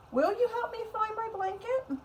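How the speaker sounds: pleading puppet voice. Acted. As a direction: ask for help like a small child. Puppet asks for help.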